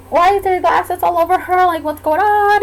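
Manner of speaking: imitating worried onlookers, pitched high; retold bewildered, anxious questioning; alarmed bystanders. Onlookers seeing glass all over the patient, alarmed.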